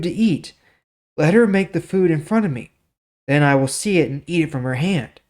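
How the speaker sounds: measured storytelling read of scripture; story reading. Acted. Teacher reading the Bible story to viewers, steady narration. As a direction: read the passage like a story to listeners.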